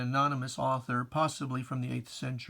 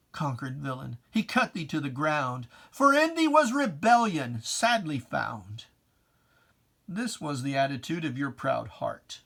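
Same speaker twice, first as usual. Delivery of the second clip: poem recitation; 5 dB louder than usual. Acted. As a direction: recite it like a sermon in verse, swelling on each image. Recites his own poem.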